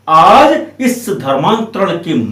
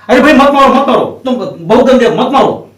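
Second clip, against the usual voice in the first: raised voice, higher and louder at first, then easing; mock protest; 5 semitones higher, 5 dB louder than usual. Brother pretending to stop his brother's beating, mock protest. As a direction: put on a show of stopping someone.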